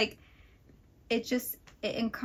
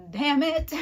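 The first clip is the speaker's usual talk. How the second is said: louder, higher exasperated cry; mock outburst; 6 semitones higher, 7 dB louder than usual. Reader retelling her reaction to a twist, exasperated outburst. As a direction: throw it out like you've been betrayed.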